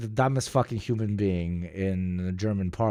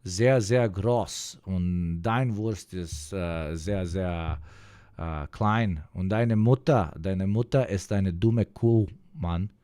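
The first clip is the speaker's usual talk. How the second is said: slow, mocking German accent; lightly put-on German speaker; 3 semitones lower, slower than usual. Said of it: "give us your best German accent".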